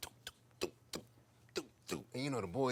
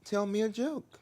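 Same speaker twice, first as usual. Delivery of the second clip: drag queen; 11 semitones higher, 4 dB louder than usual. Retold or imitated. Drag queen demanding a joke.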